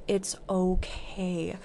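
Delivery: slow, soft, tender reassurance; self-soothing. Real, not acted. Hugging and soothing herself.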